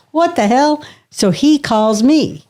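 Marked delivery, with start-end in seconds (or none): 0.1-0.8 high, startled what the hell; boss's shocked outburst. Retold or imitated. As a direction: blurt it out like a stunned boss. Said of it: "My boss goes".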